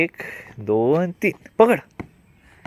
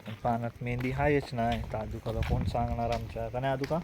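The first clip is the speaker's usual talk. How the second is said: quiet vlog aside; 7 dB quieter than usual. Real, not acted. Parent tells the camera what they are doing next, soft and quiet. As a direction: talk softly, just for the viewers.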